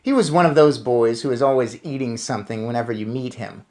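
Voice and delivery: ordinary delivery, nothing marked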